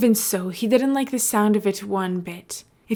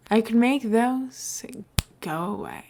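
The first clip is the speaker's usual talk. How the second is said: tempting whisper; 3 semitones higher than usual. Acted. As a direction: dangle the offer, breathe the last words. Scar tempting, ends whispered.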